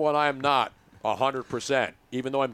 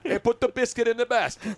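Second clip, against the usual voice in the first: announcer voice; 7 semitones higher than usual. Pretend. Mock hockey broadcast call.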